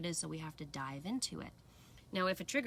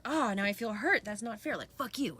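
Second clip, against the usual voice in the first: acted anger; 4 semitones higher, 5 dB louder than usual. Acted angry inner reaction.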